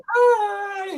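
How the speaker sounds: high strangled yelp of a greeting; embarrassed squeak; squeaky awkward hi. Retold or imitated. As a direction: blurt it out high and cracking. Awkward greeting to naked neighbour.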